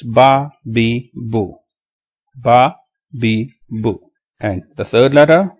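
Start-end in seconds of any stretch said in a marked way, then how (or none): 0.0-4.0 read without spelling. Shown as a demonstration. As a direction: separate syllables, recited like a drill. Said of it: "Without spelling".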